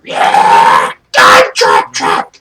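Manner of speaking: low, loud, slow chanted cheer; deep-voiced kobold cheering loudly; crowd cheer. Kobold crowd approves of the trap, low loud cheer. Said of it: "And they cheer".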